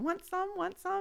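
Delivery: put-on high, soft little-kid asking; small child begging sweetly; toddler voice.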